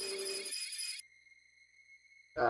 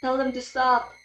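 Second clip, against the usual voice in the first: high-pitched alarmed plea; acted panic; panicked pleading; 8 semitones higher than usual. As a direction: beg them to stop, voice climbing. Game character panicking, pleading.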